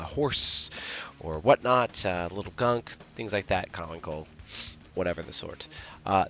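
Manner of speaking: ordinary talk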